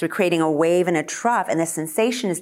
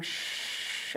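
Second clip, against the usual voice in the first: soft unvoiced shh; shushing exhale; 16 dB quieter than usual. Shown as a demonstration. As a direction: breathe out on a shh. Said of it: "contract the diaphragm".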